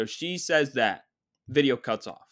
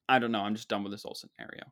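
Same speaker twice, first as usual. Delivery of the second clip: ordinary delivery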